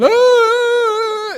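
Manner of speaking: long, high, loud panicked wail; child's scream. Retold or imitated. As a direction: howl like the boy blinded by water. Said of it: "and I'm going".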